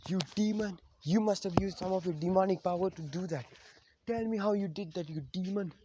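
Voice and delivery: high-pitched, mock-angry outburst; angry accusation. Menma accusing Naruto of being a demon, retold angry and high. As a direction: raise the pitch and fling the accusation. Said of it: "then Menma gets angry".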